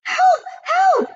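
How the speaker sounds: very high, loud panicked scream; a terrified wife calling for help; shrill piercing cry for help. A narrator's reading. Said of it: "screamed Missus Jenkins".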